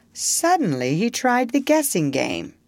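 usual speaking voice

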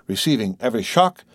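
plain reading voice

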